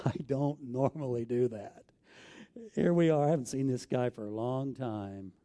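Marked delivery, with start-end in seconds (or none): 0.0-1.8 laughing repeat. Real, not acted. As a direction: chuckle through don't normally do that. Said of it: "If you know me at all".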